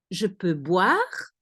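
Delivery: "Je peux boire" slowly, rising on "boire"; slow demonstration question, "boire" going up; question intonation. Shown as a demonstration. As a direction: ask "Je peux boire" with a slow lift at the end. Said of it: "you use the intonation of a question".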